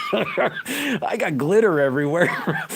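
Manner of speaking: laughing through the words; giggling while telling it; high laughing voice. Real, not acted.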